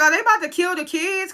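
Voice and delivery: incredulous confusion. Retold or imitated. Viewer asking about the kids' fate, incredulous confusion. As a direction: replay your alarm as a high, puzzled question. Retelling own confused reaction to show.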